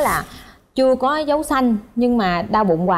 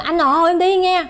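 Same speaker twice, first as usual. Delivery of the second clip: higher-pitched call to husband; 7 semitones higher than usual. Retold or imitated. Wife calls husband as she's wheeled away, calling out. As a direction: call out a goodbye to him.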